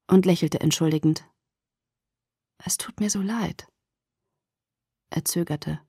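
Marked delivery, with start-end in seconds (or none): 2.6-5.8 soft unvoiced whisper. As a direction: hush the apology. Edward apologizes softly.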